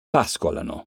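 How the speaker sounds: pascolano with PAS stressed, the rest light; stress on the first syllable. Shown as a demonstration. Teacher showing fourth-to-last stress to pupils, PAS stressed. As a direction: hit PAS in pascolano, then glide. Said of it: "cade sulla quartultima sillaba".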